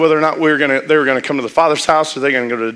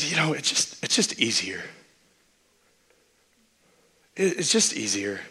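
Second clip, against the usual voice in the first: mumbled excuse; 8 dB quieter than usual. Retold or imitated. Retold friend's mumbled excuse.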